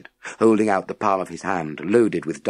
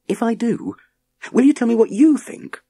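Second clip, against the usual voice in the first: put-on raised young voice, a little pushy; higher boy's voice; 5 dB louder than usual. A narrator's reading. Boy bargains with the professor in a raised young voice.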